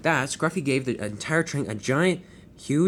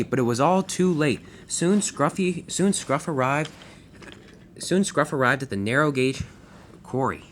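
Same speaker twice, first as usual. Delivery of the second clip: ordinary delivery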